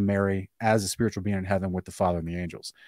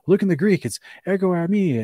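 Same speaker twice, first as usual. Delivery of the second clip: higher, smug know-it-all mimic; mocking apologists; 8 semitones higher, 4 dB louder than usual. Mocking apologists to Ken, high and smug. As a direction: parody a know-it-all quoting Greek. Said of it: "they love to say".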